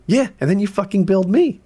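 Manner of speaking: high mocking voice of the contractor. Mocking the contractor behind his back. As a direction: sneer it as the contractor would.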